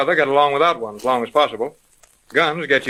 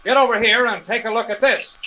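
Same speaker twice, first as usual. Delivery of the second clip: alarmed call; 7 semitones higher than usual. Acted. Sees strange man at door.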